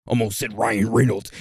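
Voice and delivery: usual speaking voice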